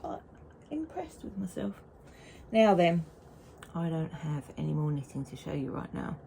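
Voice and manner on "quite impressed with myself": whispered aside to herself. Real, not acted. Knitter whispering a smug aside to viewers. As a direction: breathe it like a little secret.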